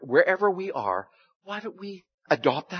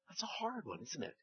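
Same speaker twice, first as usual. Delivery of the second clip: soft, confiding aside; hushed; 2 semitones higher, 20 dB quieter than usual. Real, not acted. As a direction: lean in and say it quietly.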